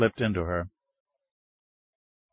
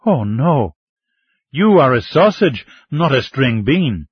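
bright, louder, eager voice; enthusiastic; 2 semitones higher, 6 dB louder, slower than usual. A narrator's reading. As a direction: say it with eager delight. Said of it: "Ming told him enthusiastically".

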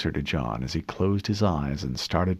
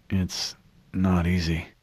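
slow, drowsy and fading; fighting to stay awake; nodding off; 3 semitones lower, slower than usual. Acted. Eyelids fluttering under sedation.

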